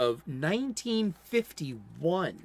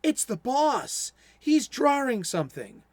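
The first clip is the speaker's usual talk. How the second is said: gasping surprise; 7 semitones higher than usual. Retold or imitated. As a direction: gasp it out, higher. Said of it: "Bo gasps".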